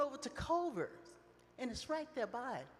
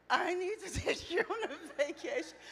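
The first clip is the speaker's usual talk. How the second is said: laughing repeat; 6 semitones higher, 3 dB louder than usual. Retold or imitated. Repeats son's line while laughing.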